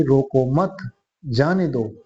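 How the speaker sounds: "रोको मत" as one unit, a break, then "जाने दो"; pause after मत. Shown as a demonstration. Teacher explaining the meaning to students, pausing after मत. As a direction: stop after मत before saying जाने दो. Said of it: "इसका कहने का भाव है".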